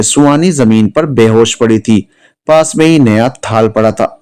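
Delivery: ordinary delivery, nothing marked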